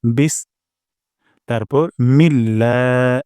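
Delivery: বিস with breathy s, then মিল্লা drawn out slowly; syllable demo. Shown as a demonstration. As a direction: split বিস and মিল্লা, air out on the s. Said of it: "বিস সিন থেকে আমরা বাতাস বের করব". (শব্দাংশ ধীরে উচ্চারণ)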